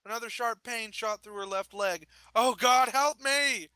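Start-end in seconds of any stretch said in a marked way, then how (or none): 2.3-3.7 high cry for help; loud, pained plea that stays high; screamed plea. A narrator's reading. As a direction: cry out in agony, pitched up. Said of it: "She screamed".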